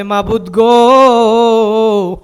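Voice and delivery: loud, long-drawn cry to God; calling out. Acted. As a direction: wail the call upward, stretching the last vowel. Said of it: "ফেরেশতারা ডাক দিয়া বলে". (টেনে আকুল ডাক)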